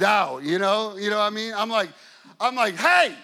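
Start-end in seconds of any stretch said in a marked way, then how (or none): 2.8-3.1 sharp, high, loud "hey!"; big booming holler; loud shout. Retold or imitated. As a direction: call out hard to stop someone in their tracks. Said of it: "if I wanna get loud".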